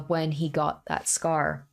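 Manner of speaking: ordinary talk, nothing marked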